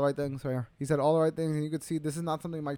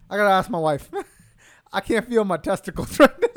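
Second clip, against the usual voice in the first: lightly hammed-up hurting fighter, a bit louder; comic, slightly raised pained-fighter voice; winded boxer imitation; 4 semitones higher, 8 dB louder than usual. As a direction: break into a laugh while mimicking a battered boxer. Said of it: "That's what it sounded like".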